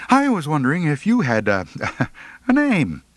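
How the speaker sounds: high, rushed and stumbling; flustered. A narrator's reading. Boy flustered asking the girl her name, high and rushed. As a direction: trip over the words, nervous laugh at the end.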